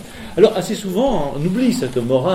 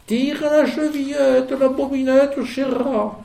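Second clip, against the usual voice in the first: put-on high, thin old granny voice, much higher; vieille grand-mère; 12 semitones higher than usual. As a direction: call out like a frail old grandmother. (grand-mère alitée qui crie, voix aiguë)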